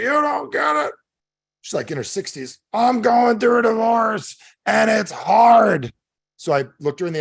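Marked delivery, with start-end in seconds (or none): 0.0-0.9 put-on very high, wailing drunk woman; hammed-up drunken wail; drunk lady. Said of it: "this drunk lady walks out of a bar". 2.7-5.9 put-on loud, high, slow drunken wail; hammed-up drunk woman bawling her complaint; drunk lady. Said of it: "this drunk lady walks out of a bar".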